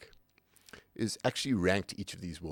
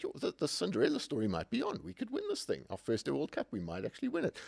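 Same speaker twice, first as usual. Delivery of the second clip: imitating hushed, dawning hope, higher and softer; lightly acted hopeful fan's thought, pitched up; retold optimism; 8 semitones higher, 3 dB quieter than usual. Fan daring to think of winning the first World Cup, retold optimism. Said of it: "We were actually optimistic".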